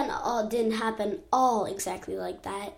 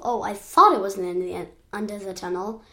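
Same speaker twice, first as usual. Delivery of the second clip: ordinary delivery, nothing marked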